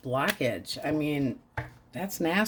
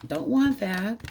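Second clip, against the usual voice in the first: "Don't want that" higher with rising "want"; emphatic repeat; 6 semitones higher than usual. Shown as a demonstration. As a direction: lift "want" high on the repeat.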